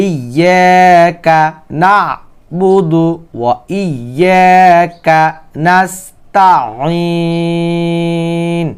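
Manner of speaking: slow, drawn-out verse recitation; Quran recitation. Shown as a demonstration. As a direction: recite slowly, stretching the long vowels and the final syllable.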